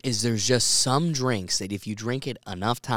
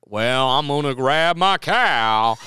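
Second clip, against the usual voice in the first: slow, louder mock Texas drawl; mock Texas accent; 2 semitones higher, 6 dB louder, slower than usual. Host mocks foreigners doing a Texas accent. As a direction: ham up a cowboy Texas accent. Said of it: "somebody goes to do".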